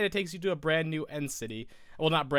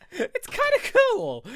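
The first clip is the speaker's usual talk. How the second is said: laughing; 7 dB louder, slower than usual. Real, not acted. Laughing at own cracking voice.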